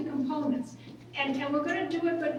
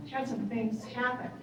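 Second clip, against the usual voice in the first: ordinary delivery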